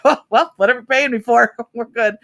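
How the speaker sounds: playful shrug. Retold or imitated. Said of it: "I was like".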